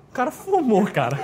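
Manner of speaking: chuckling through the words; a laugh breaking into the line. Real, not acted. Teacher mocking the absurd exam option, laughing.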